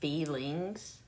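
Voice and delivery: "Feelings" as a rising "fee-lings?"; questioned standard pronunciation. Shown as a demonstration. Doubting anyone says it so, questioning "fee-lings". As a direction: query "Feelings" with long ee and full ng. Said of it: "Is that how you actually say it?".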